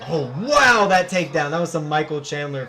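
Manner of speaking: loud, high burst of amazement; excited outburst. Real, not acted. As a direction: blurt it out, amazed. Reacting to a big takedown.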